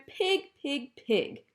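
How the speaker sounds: loud, high angry shout; furious shout at the pig. A narrator's reading. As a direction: yell at the pig. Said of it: "shouted Eugenia".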